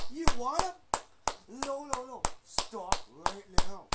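loud, slow, high angry threat. Pretend. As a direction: bark the threat at the toys. Threatening toys loudly.

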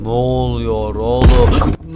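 loud, very slow stretched yell; loud, long-stretched panic at the game; drawn-out startled yell. Real, not acted. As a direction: drag every word out in alarm. Startled by moving photo.

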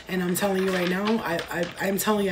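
normal talking voice

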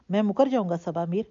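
plain reading voice

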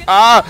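loud, high "ah!"; startled yell. Real, not acted. Player reacting to chaotic game action, startled yell. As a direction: yell out suddenly in surprise.

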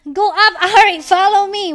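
loud command to a teammate; shouted urging. Real, not acted. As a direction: shout to get them moving.

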